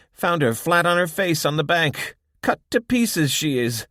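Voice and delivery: blunt and matter-of-fact; gruff old woman. A narrator's reading. Irma talks to Abby.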